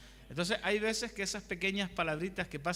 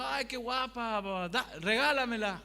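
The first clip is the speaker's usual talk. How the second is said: coaxing woman; 5 semitones higher, 4 dB louder than usual. Retold or imitated. Preacher imitating the co-worker begging for the shirt, high and wheedling. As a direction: coo over the shirt and beg for it.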